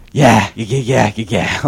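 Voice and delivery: low, quiet, offhand yeahs; mumbled yeahs. Retold or imitated. Cousin Clay brushing off the narrator, low mumbled yeahs. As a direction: toss off the yeahs without caring. Cousin Clay's dismissive reply again.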